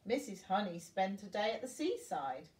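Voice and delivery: ordinary delivery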